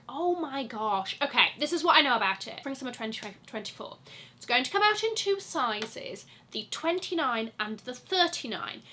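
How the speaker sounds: ordinary talk, nothing marked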